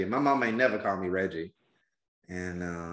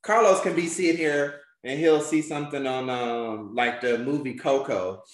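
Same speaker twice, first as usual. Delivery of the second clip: normal talking voice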